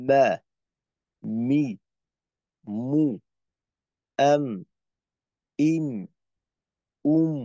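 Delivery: a moderate M on each; Arabic M drill. Shown as a demonstration. Teacher drilling the M letter, medium strength. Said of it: "যেমন".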